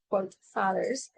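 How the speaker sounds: ordinary delivery, nothing marked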